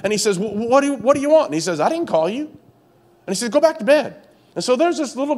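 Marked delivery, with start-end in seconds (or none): 0.4-1.5 pitched up, rising puzzled what do you want; a sleepy old priest woken, lightly voiced; groggy priest Eli. Eli answers the boy at night, lightly voiced and puzzled. 1.8-4.2 put-on higher, brushing the boy off back to bed; a hint of a tired priest waving him away; lightly voiced Eli. Eli tells Samuel to go back to bed, lightly mock-annoyed.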